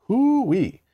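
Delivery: high delighted hoot; excited whoop. Acted. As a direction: whoop it up like an old coot. Gnome exclaims in story.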